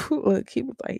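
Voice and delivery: laughing out the word Look; bursting into a laugh on Look, high and loud. Real, not acted. Vlogger laughing as she starts the car story, laughing.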